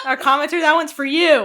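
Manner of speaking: loud, laughing call-out; pointed. Real, not acted. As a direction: aim it straight at the commenter. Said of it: "That was pointed".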